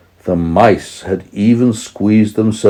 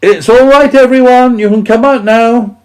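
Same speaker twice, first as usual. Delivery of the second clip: high, loud calling voice; Percy calling everyone out; 8 dB louder than usual. A narrator's reading. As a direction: call to the hidden animals. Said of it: "he called".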